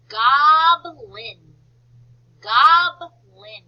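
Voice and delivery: GOBLIN loud and drawn out in two parts, GOB-LIN; syllable by syllable. Shown as a demonstration. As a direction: model GOBLIN slowly and loudly for a class. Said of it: "our first two syllable word".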